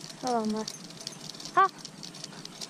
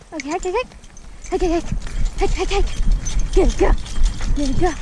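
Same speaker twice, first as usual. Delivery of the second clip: louder, higher urging calls; calling out; 4 semitones higher, 7 dB louder than usual. Real, not acted. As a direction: drive the dog on with loud calls. Commanding and praising sled dog.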